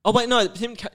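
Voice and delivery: ordinary talk, nothing marked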